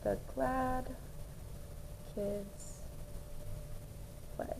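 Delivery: put-on "The glad kids play" very soft, with long pauses between words; saying while writing. Shown as a demonstration. Adult writing out the sentence for the student, saying while writing. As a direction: murmur "The glad kids play" word by word as you write it. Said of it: "going to write as the adult".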